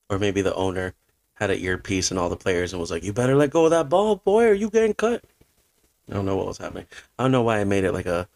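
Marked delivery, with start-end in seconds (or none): 3.0-5.2 southern man voice. Retold or imitated. Owner yelling through earpiece at players, mock southern threat. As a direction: bark it like a southern team owner. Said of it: "a southern racist man".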